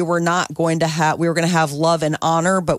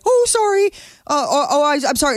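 imitated high, stammering apology; mock embarrassed fluster, pitched way up; 9 semitones higher, faster than usual. Mother-in-law walking in on crying bride, flustered mock apology.

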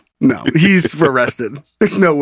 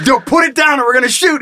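mock cop shout; 7 semitones higher than usual.

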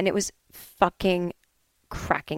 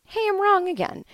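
cheerful admission; 13 semitones higher than usual. Retold or imitated. Cheerful self-quote.

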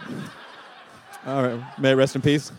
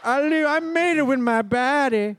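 high, put-on talking-dog voice; higher, goofy dog character; Diego the dog; 5 semitones higher than usual. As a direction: voice a simple pet dog talking to its mama. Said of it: "I do a voice of, of Chloe's dog, Diego".

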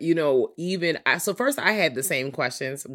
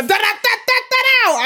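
mock rant syllables; 10 dB louder than usual.

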